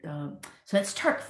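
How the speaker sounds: ordinary talk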